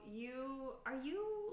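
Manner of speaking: normal talking voice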